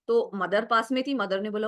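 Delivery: ordinary talk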